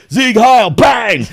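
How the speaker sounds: high, loud, hammed-up yell; over-the-top action-movie cry; mock battle shout. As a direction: shout it like a cartoon fighter. Acts out a mock action-hero fight.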